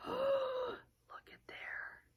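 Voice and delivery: very slow, breathy hushed whisper; whispered surprise for the kids; gasping whisper. A narrator's reading. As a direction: gasp it out in wonder. Gasp at bunnies in basket.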